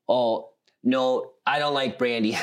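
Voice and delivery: ordinary talk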